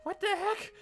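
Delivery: sudden high squeak of confusion; baffled what. Real, not acted. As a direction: jump way up in pitch, baffled. Confused by the treasure map.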